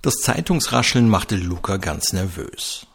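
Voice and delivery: usual speaking voice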